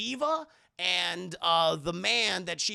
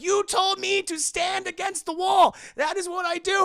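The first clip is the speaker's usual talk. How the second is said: much higher, much louder accusing yell; high panicked shout; 13 semitones higher, 8 dB louder than usual. Acted. Short Round yelling at Indy as spikes descend. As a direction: scream it like a panicked kid.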